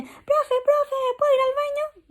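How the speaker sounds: put-on high childish pleading voice; high-pitched mock child voice; pupil asking. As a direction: play a small kid begging the teacher.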